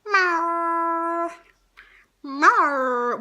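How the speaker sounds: a long cat call; mewing cat; cat meow. Shown as a demonstration. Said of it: "animal impressions".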